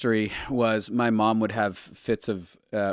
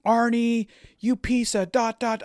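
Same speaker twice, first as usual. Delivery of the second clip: much higher, louder furious yell at grandpa; retold scream; 13 semitones higher, 4 dB louder than usual. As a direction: scream at him in rage. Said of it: "I started screaming".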